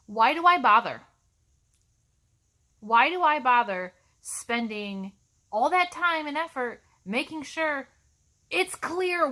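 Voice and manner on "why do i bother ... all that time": loud, exasperated cry of why bother; teacher fed up with lazy student emails, loud exasperation; frustrated outburst. Real, not acted. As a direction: throw up your hands in frustration. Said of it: "I am frustrated".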